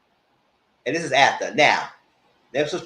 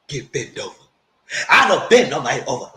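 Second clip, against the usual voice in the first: usual speaking voice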